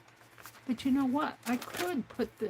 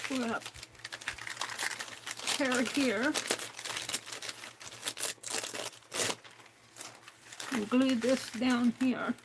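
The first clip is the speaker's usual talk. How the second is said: strained after choking; choking on a drink; 4 dB quieter than usual. Real, not acted. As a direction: talk with a scratchy throat. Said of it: "I'm choking".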